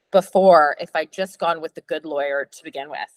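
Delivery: ordinary talk, nothing marked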